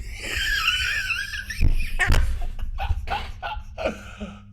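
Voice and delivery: long, very high-pitched laughing fit; high laughter. Real, not acted. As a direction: crack up in a high squeal. Cracks up at own line.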